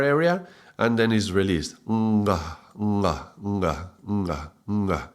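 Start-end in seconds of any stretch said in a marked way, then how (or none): 1.9-5.1 "nga" repeated softly, tongue back against the soft palate; nasal "nga" drilled over and over; velar nga drill. Shown as a demonstration. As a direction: hum "nga" from the back of the mouth. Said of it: "the tongue goes back".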